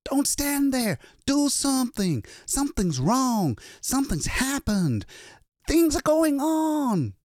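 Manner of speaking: high-pitched, panicked shouting; frantic scream. A narrator's reading. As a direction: scream at onlookers, desperate for action. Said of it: "he screamed".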